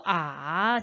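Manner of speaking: 아 dipping low and coming back up; third tone. Shown as a demonstration. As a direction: scoop 아 down, then lift it. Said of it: "삼성". (아를 낮게 내렸다 올려, 삼성으로)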